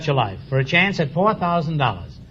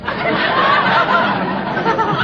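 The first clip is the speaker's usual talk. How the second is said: host laughing. Real, not acted. Host laughs at his own joke.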